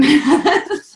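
short high laugh; real laugh. Real, not acted. Mother laughing at her own labour bravado. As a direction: laugh at yourself.

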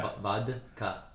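slow, halting "vodka" in pieces, VOD-ka; careful, effortful VOD-ka; D attempted. Shown as a demonstration. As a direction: sound out "vodka" with the D. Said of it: "There you go".

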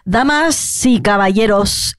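slow "Damas y caballeros" with hissed S; imitated teacher's sibilance. Mocking the maths teacher, slow hissing S on "Damas y caballeros". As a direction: stretch every final S in "Damas y caballeros". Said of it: "y yo la hacía así".

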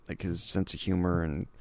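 normal talking voice

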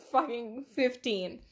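ordinary talk, nothing marked

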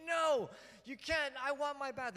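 put-on toddler meltdown. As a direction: imitate a small child's tantrum wail. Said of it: "she was just having a meltdown".

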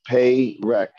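PAYREK with long ay first, short eh last; wrong first vowel. Shown as a demonstration. Teacher testing students with a wrong one, PAYREK with long ay first. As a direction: say PAYREK as pay-rek. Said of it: "Also wrong".

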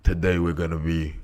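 low, soft host impression; Sam Elliott. Guest host trying to open the show, deep impression. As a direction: keep it deep and understated. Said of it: "hosted by Sam Elliott".

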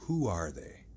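slow, lightly husky echo; a little hoarse. Acted. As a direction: echo it with a slight rasp. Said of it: "echoed Mister Hyde a little hoarsely".

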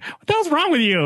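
high, hammed-up yell of an annoyed dad; mock angry dad. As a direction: play the dad bursting into the room. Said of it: "He's like".

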